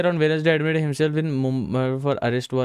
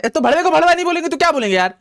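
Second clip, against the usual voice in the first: fast, high, loud protest; rapid, worked-up self-justification; exasperated outburst; 12 semitones higher, 5 dB louder, much faster than usual. Real, not acted. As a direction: burst out in high-pitched indignation. Outburst defending his own swearing.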